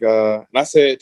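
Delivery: usual speaking voice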